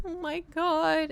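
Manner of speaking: high, slow drawn-out groan; exasperated. Retold or imitated. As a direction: stretch it out in disbelief. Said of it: "and I'm like".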